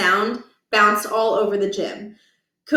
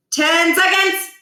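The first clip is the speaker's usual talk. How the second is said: coach countdown shout; 8 semitones higher, 5 dB louder, slower than usual. Acted.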